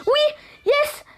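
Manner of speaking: high-pitched squeals of joy; real excited screaming. Real, not acted. Gamer shrieking to friends over a lucky box opening, screaming joy. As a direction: cry out "yes" in ecstatic, piercing bursts. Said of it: "si jamais j'ai cri-, crié fort".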